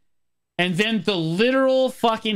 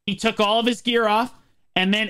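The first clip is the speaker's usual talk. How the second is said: normal talking voice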